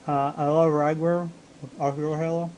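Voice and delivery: talking while eating cookies; words round a bite of cookie; mouth full. Real, not acted. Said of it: "Sorry, I'm, uh, eating chips".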